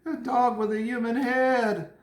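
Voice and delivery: high, loud, slow horrified lady voice; shocked woman. Pretend. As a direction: ham up a lady appalled by the dog. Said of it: "She's like".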